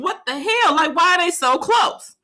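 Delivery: high, alarmed disbelief; shocked at a van driving too close. Retold or imitated. Wife startled by van in traffic, high alarmed voice. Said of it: "And I'm like".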